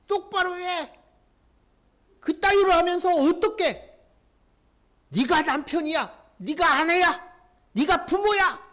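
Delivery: high, loud sneering accuser; loud mocking voice of Satan scolding a family; Satan's taunt. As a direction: berate the spouse as Satan would, with scorn. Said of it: "라고 얘기합니다".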